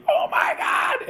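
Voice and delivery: imitating a very high, drawn-out scream down a phone. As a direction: scream it like a horrified parent. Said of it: "you hear this scream".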